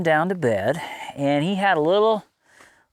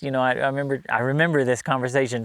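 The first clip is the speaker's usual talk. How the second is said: normal talking voice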